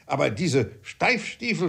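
high-pitched protesting father; worked-up father defending himself; indignant father. Acted.

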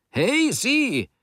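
very high, hailing shout; acted shout, pitch leaping up. A narrator's reading. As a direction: shout to stop someone leaving. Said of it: "schrie der Reinigungsmann wieder".

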